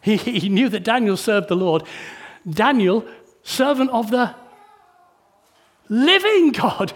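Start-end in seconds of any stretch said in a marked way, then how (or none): 2.5-6.9 anguished call. Acted. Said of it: "he called to Daniel in an anguished voice".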